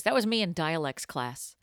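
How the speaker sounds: usual speaking voice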